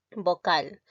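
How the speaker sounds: ordinary talk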